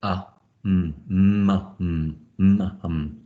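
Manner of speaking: "m" hummed straight into the vowel, no em; segmented phonics sounds, "m" as a bare hum; pure sounds blended. Shown as a demonstration. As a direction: blend pure "m" and short "a" toward mum. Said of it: "you've got to make sure".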